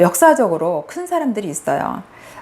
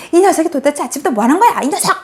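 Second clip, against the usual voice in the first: higher, louder, fast acted scolding; furious mother; 6 semitones higher, 3 dB louder, faster than usual. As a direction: blow up at the child, building to the last cry. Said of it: "뭐 이렇게". (폭발하며 아이를 혼내는 엄마)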